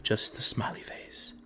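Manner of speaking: hushed whispered aside; whispered deadpan. Real, not acted. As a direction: breathe it out in a whisper.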